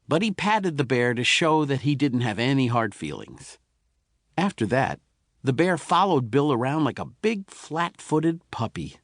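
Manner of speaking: ordinary delivery, nothing marked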